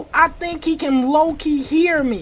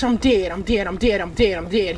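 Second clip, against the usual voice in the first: low, soft, run-together repeats; hushed nervous babble; panicky muttering; 8 semitones lower, 5 dB quieter, faster than usual. Real, not acted. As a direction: mutter it over and over, doomed. Muttering panic while chased.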